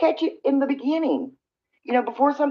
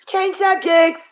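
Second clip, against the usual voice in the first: high, louder brush-off; brisk subject change; 9 semitones higher, 4 dB louder, slower than usual. Real, not acted. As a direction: snap out of it and change topic. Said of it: "Mm, okay".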